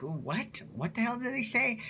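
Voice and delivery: hushed puzzled question rising high; baffled viewers. Retold or imitated. London ladies puzzled by a thick accent, mock bafflement. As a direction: imitate confused viewers, soft then high-pitched.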